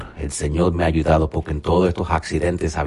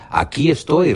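Abraham answering; 11 semitones higher, 4 dB louder than usual. Acted. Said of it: "respondió".

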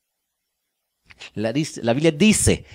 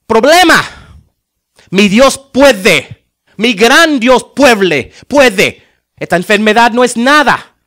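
bold declaration; 5 semitones higher, 5 dB louder than usual. Pretend. Pastor shows the congregation how to pray boldly, loud and commanding. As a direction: declare it over the problem with full faith. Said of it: "No, dile".

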